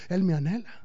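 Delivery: ordinary delivery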